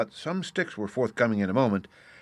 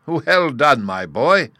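loud, high, approving cheer; exultant congratulation at volume; hearty cry; 6 semitones higher, 8 dB louder, much slower than usual. A narrator's reading. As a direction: cry out your praise. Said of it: "cried the Vice Warden".

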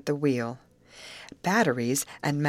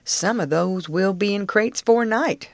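lightly voiced driver, higher and louder; a hint of a country driver; driver's voice; 4 semitones higher, 5 dB louder than usual. A narrator's reading. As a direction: call it out a little like a driver. Narrator voices the southern driver.

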